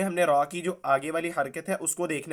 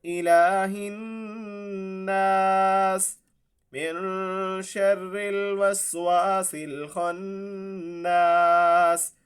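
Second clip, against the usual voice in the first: slow melodic tajweed chant, higher; Quran recitation; 3 semitones higher, much slower than usual. Shown as a demonstration. Teacher reciting the surah once through for students.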